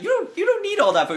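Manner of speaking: high-pitched, hurried protest; arguing. Acted. Game master voices a man arguing over food, high bickering. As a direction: argue in a high, quick voice.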